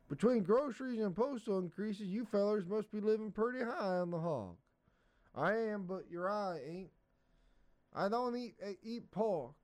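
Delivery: high, folksy country voices; rustic banter at the post office; hillbilly characters. Acted. Stuffy and postman voiced.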